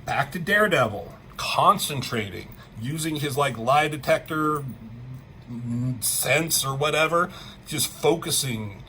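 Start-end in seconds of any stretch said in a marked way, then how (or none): none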